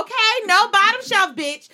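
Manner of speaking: emphatic. Real, not acted. Emphatic punchline about drinks.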